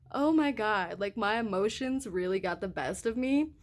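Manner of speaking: ordinary delivery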